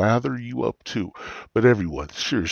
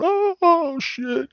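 panicked waking; 3 dB louder, much slower than usual. Retold or imitated. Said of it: "I'm waking up going".

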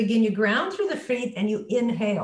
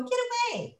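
very high, timid little voice; high-pitched, ineffectual plea; weak high squeak; 12 semitones higher, 4 dB quieter, faster than usual. Shown as a demonstration. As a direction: pipe it up high without force. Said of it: "I'm not gonna go".

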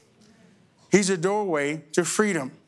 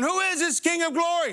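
mocking skeptic; 8 semitones higher than usual. Mock quote of skeptics.

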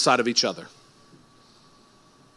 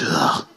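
disgusted ugh; 13 semitones lower, 3 dB quieter than usual. Pretend. Sound after mock affection.

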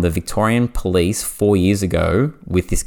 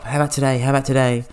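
mock pushy nagging, pitched higher; nagging repeat; 7 semitones higher, much faster than usual. Pushy customer repeating himself, mock nagging. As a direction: hammer the same question like a pest. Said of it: "He messaged nonstop".

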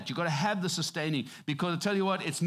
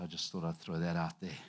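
dropped low and much softer, throwaway; quiet aside; 11 semitones lower, 7 dB quieter than usual. Real, not acted. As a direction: toss it off under your breath. Quiet aside during sermon.

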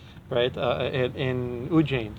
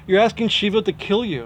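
alarmed correction; 6 semitones higher than usual. Retold or imitated. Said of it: "So what, what wrong?".

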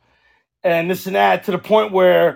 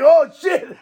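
put-on kid's guilty face; 7 semitones higher, 4 dB louder than usual. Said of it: "his face is like".

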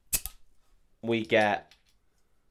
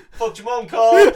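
heckler yell; 12 semitones higher, 13 dB louder, slower than usual. Retold or imitated. Heckler again.

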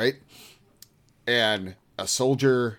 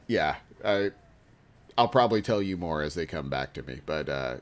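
ordinary delivery

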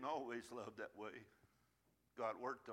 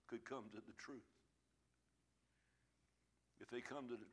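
soft altar appeal; 2 semitones lower, 3 dB quieter than usual. Acted. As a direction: draw them in gently.

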